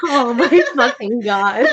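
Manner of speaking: slow, laughing groan of despair. Real, not acted. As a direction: moan it through helpless laughter.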